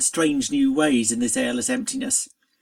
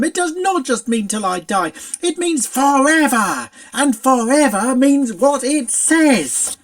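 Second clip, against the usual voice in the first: pony defending her promise, loud and fierce; harsh insistence; 7 semitones higher, 6 dB louder than usual. A narrator's reading. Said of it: "The harshness of her tone".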